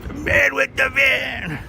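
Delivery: high, slow, hammed-up announcer on man; mock announcer voice. Streamer to chat, hyping up the van man, mock announcer voice.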